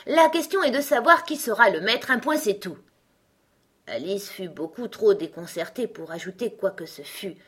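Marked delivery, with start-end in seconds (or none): 0.1-2.8 loud, high, overbearing character voice; imperious Gros Coco. Acted. Gros Coco answers Alice's doubt, imperious. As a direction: shut down the objection firmly.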